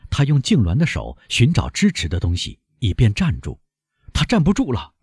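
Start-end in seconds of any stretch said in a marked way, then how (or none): 4.1-4.9 high-pitched cry of alarm; sudden high warning cry; alarmed shout. A narrator's reading. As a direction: call out urgently as someone starts to collapse. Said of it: "莫雷尔喊道".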